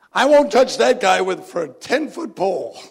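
loud, high, scornful mock outburst; disgusted refusal. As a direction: scoff loudly at the very idea. Gossiper refusing the sinner.

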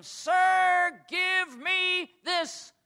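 high, slow cry "Sir, give me this"; pleading cry. Acted. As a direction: beg with a loud call. Woman pleads to Jesus.

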